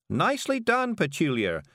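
high-pitched praise; applauding witch. A narrator's reading. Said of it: "clapping with all four hands".

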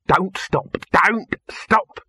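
urgent order. A narrator's reading. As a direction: call it out, punching each word. Dibbler shouting over the handleman.

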